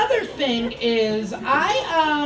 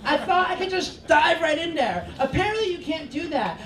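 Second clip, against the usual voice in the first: normal talking voice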